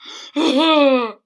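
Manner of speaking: loud tearful gasp; gasping sob. A narrator's reading. Old king breaking into a sob.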